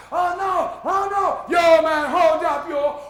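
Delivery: high, loud hammed-up alarm; mock panic. Man panicking as planes fly over the Bronx, loud alarm.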